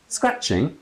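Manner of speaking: startled echo; surprised. Acted. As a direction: repeat it before you can stop. Blurted echo.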